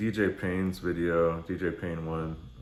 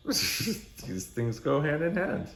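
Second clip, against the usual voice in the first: laughing; 8 semitones higher, slower than usual. Real, not acted. Joking about rappers' shitty cars.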